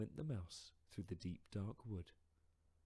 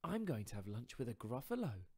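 higher, bright little mouse voice; the mouse answering fox politely; 4 semitones higher, 5 dB louder than usual. Acted. Mouse telling fox about lunch plans, higher voice.

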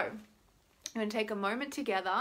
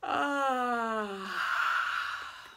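long falling yawning "ah"; big yawn ending in breath; pushed-out yawn. Shown as a demonstration. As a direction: push the yawn all the way out. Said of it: "keep pushing that yawn out".

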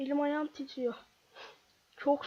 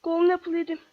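urgent call; 3 semitones higher, 8 dB louder than usual. Real, not acted. Eating apple mid-fight.

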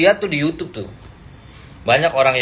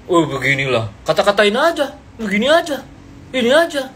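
mocking imitation; 5 semitones higher than usual. Mocking others' opinion steering.

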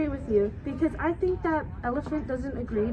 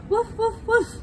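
high yappy woof; barking dog; 10 semitones higher, 6 dB louder, slower than usual. Acted. The dog friend barks, high and yappy. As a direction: yap like a small dog.

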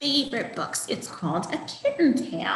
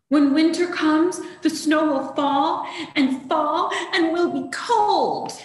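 worried kitten; 6 semitones higher, 6 dB louder than usual. Acted. First kitten telling siblings snow scares it, high fretful. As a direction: fret about the snow as a small kitten.

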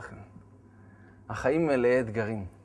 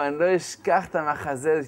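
ordinary talk, nothing marked

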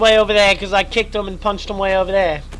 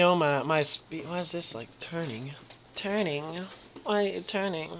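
quiet puzzled; 4 semitones lower, 16 dB quieter than usual. Real, not acted. Confused at game camera.

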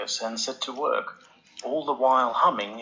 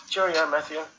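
Charles farewell; 6 semitones higher than usual. A narrator's reading.

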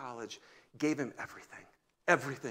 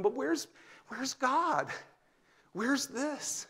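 mock plaintive search; 11 semitones higher, much slower than usual. Lost believer asking.

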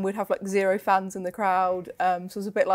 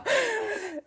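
high-pitched burst of laughter; laughing. Real, not acted. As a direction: break up laughing at your own story. Laughs after own mock quote.

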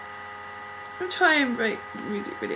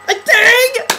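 excited squeal; 11 semitones higher, 10 dB louder than usual. Real, not acted. Excited exclamation.

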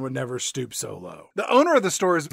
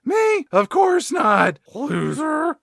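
very high mock denial, then insult; bratty kid role; 10 semitones higher, 5 dB louder, much slower than usual. Mall rep denies insulting owner then insults him, high voice. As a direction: deny it high and drawn-out, slip in a jab. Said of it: "this Brockman guy's like".

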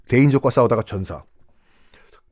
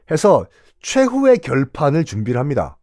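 ordinary talk

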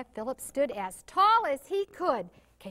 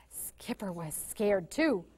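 ordinary delivery, nothing marked

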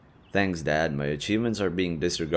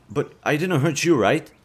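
higher, louder worried plea; urgently seek reassurance, pitch raised; anxious checking; 7 semitones higher, 5 dB louder than usual. Acted. Fears having hurt partner.

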